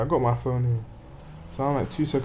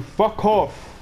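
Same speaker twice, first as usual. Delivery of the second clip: higher, exasperated shout; angry outburst; 8 semitones higher, 4 dB louder than usual. Real, not acted. Gamer swearing at the game while stuck, angry outburst. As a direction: snap it out in frustration.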